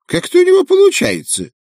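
high squawky pelican exclaim. Acted. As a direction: cry out like an old pelican. Pelican continues.

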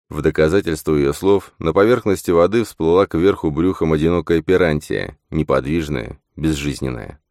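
usual speaking voice

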